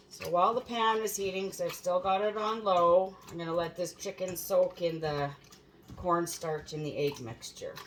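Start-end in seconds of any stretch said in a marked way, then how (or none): none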